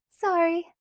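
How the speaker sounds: high breezy fake sorry; unapologetic. A narrator's reading. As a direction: say sorry without meaning it. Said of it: "not sounding the least bit penitent".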